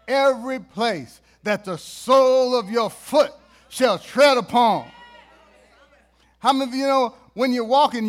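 fiery, loud preaching. Acted. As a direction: preach it out to the crowd. Preaching at full force.

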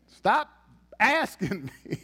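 ordinary talk, nothing marked

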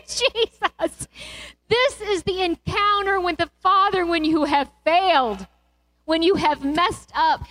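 high, slow, impassioned preaching; soaring pulpit delivery; fervent preaching. Acted. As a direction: lift the congregation with rising fervour. Preaching the father's embrace.

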